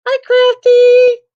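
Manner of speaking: sing-song called greeting. Acted. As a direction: call it out brightly across the room. Calling out a greeting.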